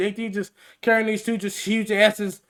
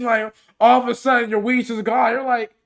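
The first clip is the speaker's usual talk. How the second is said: ordinary talk, nothing marked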